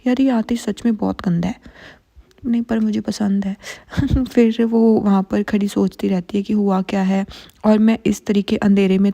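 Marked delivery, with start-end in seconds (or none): 3.6-4.2 a quick breathy giggle; short giggle. Real, not acted. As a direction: giggle at her own confession. Laughs at liking the rude hero. (छोटी सी हँसी)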